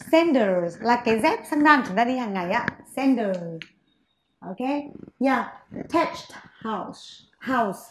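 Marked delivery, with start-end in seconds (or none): none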